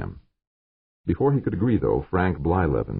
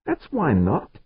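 snarl; 12 semitones higher, slower than usual. A narrator's reading. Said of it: "George snarled".

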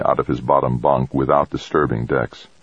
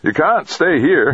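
high-pitched angry yell; raised, indignant voice, pitched way up; angry shout; 13 semitones higher, 4 dB louder than usual. A narrator's reading. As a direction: shout them off the premises. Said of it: "he shouted angrily".

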